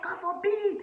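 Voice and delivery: very high, loud mock-horrified mom; shocked mother. Imitating her mom's horror at a fifty-year-old man, high and loud. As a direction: shriek it like a scandalized mother. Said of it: "She's like".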